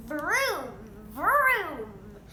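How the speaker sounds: loud revving vroom; motorbike vroom. Acted. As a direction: rev it like an engine. Bean rides his motorbike.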